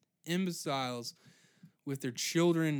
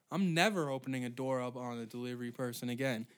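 usual speaking voice

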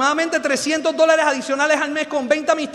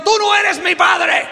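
mock child's yell, louder and higher, slow; defiant shout; 5 semitones higher, 5 dB louder, slower than usual. Child defying the father, mock shouted. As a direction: fling it like a rebellious kid.

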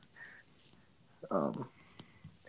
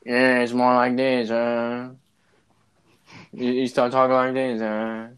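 imitating a southern British accent, a bit louder; 3 dB louder than usual. Explaining to a friend how southern Brits talk, southern British accent. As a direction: show how people talk in southern Britain. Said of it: "southern British accent is".

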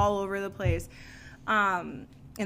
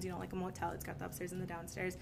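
ordinary talk

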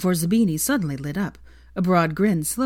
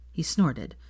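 usual speaking voice

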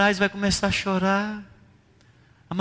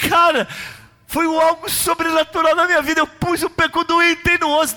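full-force preaching; 8 semitones higher than usual. Acted. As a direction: build it up like a revival preacher.

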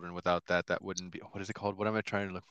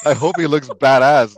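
loud hopeful exclamation; 4 semitones higher, 12 dB louder than usual. Real, not acted. Excited gushing about cool name.

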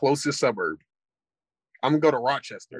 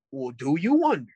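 normal talking voice

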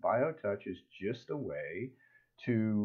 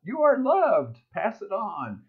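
high, louder cartoon critter voice; cartoon lion; 10 semitones higher, 7 dB louder, slower than usual. The lion hugging the giraffe, high and cheerful. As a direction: chirp it like a happy little animal. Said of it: "the lion is hugging a giraffe".

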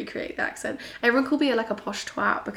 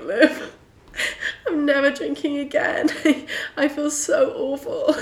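higher, louder mock lament through laughter; mock hungover wail; 6 semitones higher, 4 dB louder, slower than usual. Phoning a friend after a big night, hammed-up hungover wail. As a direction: wail it in exaggerated self-pity, laughing.

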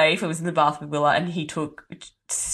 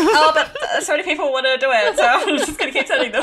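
playful protest; 9 semitones higher than usual. Retold or imitated. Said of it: "I'm like".

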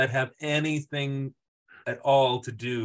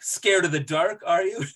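mock taunt; 5 semitones higher, 4 dB louder than usual. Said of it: "And, like".

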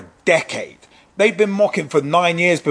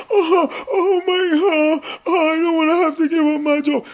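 high, whiny fake sobbing; mocking a resigning official's fake sobs; mock crying; 12 semitones higher than usual. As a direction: ham up crocodile tears. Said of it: "Crocodile goddamn tears".

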